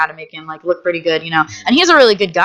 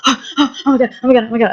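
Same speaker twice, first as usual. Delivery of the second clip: mock panic; 4 semitones higher, much faster than usual. Panic attack voiced.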